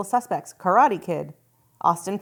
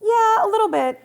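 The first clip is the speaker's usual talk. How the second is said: mock [name] voice; 13 semitones higher, 6 dB louder than usual. Mimicking [name] voice back.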